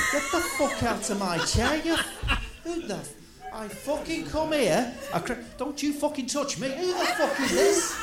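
scared and angry. Acted. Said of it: "Reg, we're recording".

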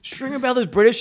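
high, loud mock disbelief; incredulous. Store staff learning actor is British, loud incredulity. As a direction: exclaim it as a retold shock.

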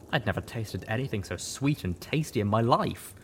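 usual speaking voice

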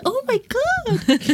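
high, gushing mock-American excitement; Americans crazy for Paris. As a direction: imitate a starstruck American, squealing. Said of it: "ils sont tellement fous de ça".

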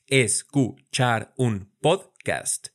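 "Escuchar un podcast" drawn out slowly, syllable by syllable; slowly split into syllables. Shown as a demonstration. Teacher splitting a phrase into syllables for students. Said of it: "Vamos a practicar nuestra división silábica".

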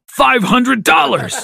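high, drawn-out exclamation; excited scream. A narrator's reading. Uncle jumping up at a lottery win, excited scream. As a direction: shout it out at a sudden win. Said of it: "Uncle Tyler screamed again".

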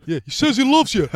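very high, loud relayed shout; shouting down the platform. Retold or imitated. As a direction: pass the message on at full voice. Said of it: "they're shouting down the platform".

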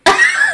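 squealing laugh; high burst of laughter. Real, not acted. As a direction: break into a shrill laugh. Laughing at herself.

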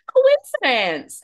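high, loud, slow coincidence; coy. Retold or imitated. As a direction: stretch it out, coy and knowing. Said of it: "I was like".

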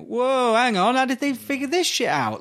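high-pitched astonished reaction; amazed. Retold or imitated. Game developers seeing Mario sixty-four's tricks, amazed. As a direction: imitate someone baffled and impressed.

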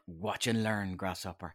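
lightly voiced sage, low and soft; a hint of a kung fu master; dad teasing his son like a sage; wise old master. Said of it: "And he was like".

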